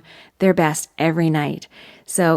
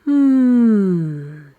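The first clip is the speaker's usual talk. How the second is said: open, round hum with more volume; resonant hum; 2 semitones higher, 4 dB louder than usual. Shown as a demonstration. Vocal coach demonstrating a good hum, resonant and open. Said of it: "sounds a little bit like this".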